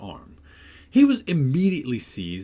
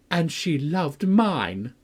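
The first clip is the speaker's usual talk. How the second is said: slow taunt; 6 semitones higher, 3 dB louder, much slower than usual. A narrator's reading. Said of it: "added he".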